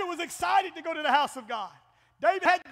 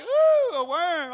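mock delighted ooh; 3 semitones higher, 6 dB louder, much slower than usual. Pastor imitating churchgoers, delighted ooh. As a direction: gush over the worm like a thrilled kid. Congregation marveling at worms.

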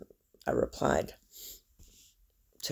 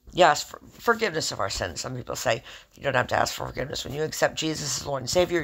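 ordinary delivery

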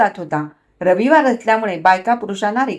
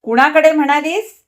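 sharp, raised, higher; sharp angry demand; 6 semitones higher, 6 dB louder than usual. A narrator's reading. Husband demands to know whose place, sharply angry. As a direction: snap the question back hard. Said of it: "तीव्र स्वरात म्हटले".

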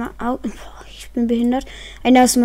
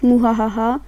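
evil chuckle. Acted. Pretend hacker evil laugh.

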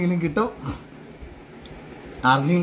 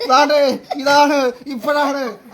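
excited countdown; 8 semitones higher, slower than usual. Real, not acted. Giveaway countdown and winner reveal.

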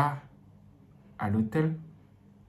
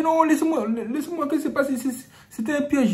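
pleading protest; 13 semitones higher, 4 dB louder than usual. Acted. Priest protests in acted scene.

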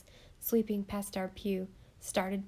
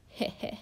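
fiendish chuckle. A narrator's reading. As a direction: chuckle wickedly under your breath. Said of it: "Peg chuckled quite fiendishly".

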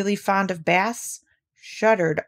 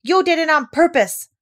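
high, loud, broken-up accusation; indignant, halting high outburst; spluttering; 11 semitones higher, 7 dB louder than usual. A narrator's reading. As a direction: splutter it out with false starts. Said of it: "spluttered Piglet".

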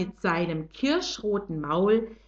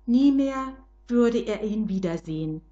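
ordinary delivery

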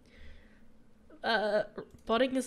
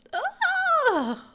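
long, loud, very high scream; frustrated scream; 8 dB louder than usual. Real, not acted. Frustrated at the game.

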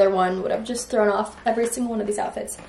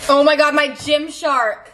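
gushing over a package; thrilled, drawn-out squeal of joy; excited; 5 semitones higher, 4 dB louder than usual. Real, not acted. Package finally arrived.

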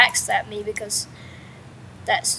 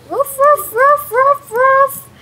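high, loud mock woofing; imitating dog barks; 13 semitones higher, 6 dB louder, slower than usual. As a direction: yap repeatedly like an excited dog. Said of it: "My dog is barking and barking like".

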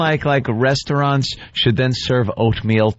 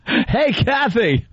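mock Eric voice; 8 semitones higher, much slower than usual. Voicing Eric to Kathy.

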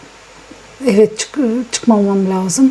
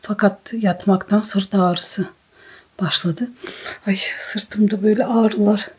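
soft whisper through the aches; 8 dB quieter, slower than usual. Real, not acted. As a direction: murmur it weakly, barely voiced. Sick woman describing pain. (ağrı içinde fısıltı)

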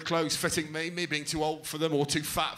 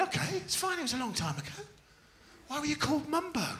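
mock sympathetic; 12 semitones higher than usual. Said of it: "And I went".

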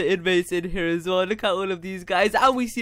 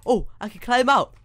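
ordinary talk, nothing marked

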